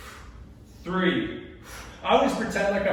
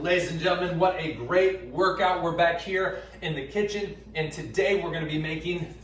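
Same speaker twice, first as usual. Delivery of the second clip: ordinary talk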